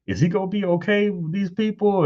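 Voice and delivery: high anxious imitation of his wife; worried wife. A husband mimicking his stressed wife about school, high and worried. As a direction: voice her fretting in a high pitch.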